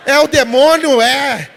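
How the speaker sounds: loud, emphatic outburst; hammed-up booming declaration; loud mock exclamation. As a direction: blurt it out loudly in mock alarm. Startled exclamation in retold encounter.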